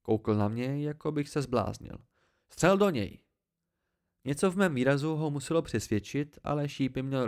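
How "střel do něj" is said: loud, high command; urgent shout. A narrator's reading. Narrator urges Martin to shoot, loud, high shout. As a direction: shout the order over the storm.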